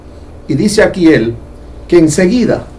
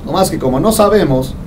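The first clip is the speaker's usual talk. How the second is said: ordinary delivery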